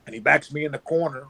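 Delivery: normal talking voice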